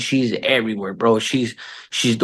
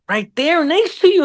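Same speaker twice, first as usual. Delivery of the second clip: very high child imitation; exasperated kid. Kids point out the actor beside their dad, exasperated kid. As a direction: squeal it like an insistent kid.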